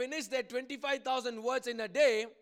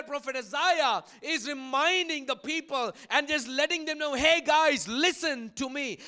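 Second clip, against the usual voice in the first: loud, raised sermon delivery; forceful preaching; 3 semitones higher, 8 dB louder than usual. Real, not acted. Preacher warning Pentecostals about forgetting forefathers, forceful preaching. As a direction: preach it out to the whole hall.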